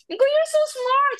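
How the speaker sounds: very high, drawn-out acted praise; gushing praise. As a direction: praise a child, stretched out and high. Voicing own praise of pupil. (nagdayeg, taas kaayo ug tingog)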